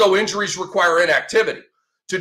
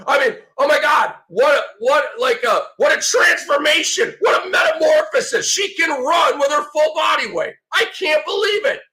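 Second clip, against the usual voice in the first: sarcastic mock amazement; 7 semitones higher than usual. Host ridiculing the injury report, mock amazement. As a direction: gush in fake wonder at nothing.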